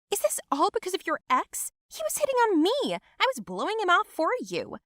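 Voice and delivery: high, loud defensive yelling; shrill defense. Acted. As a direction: yell back defensively. Cousin defends herself shouting.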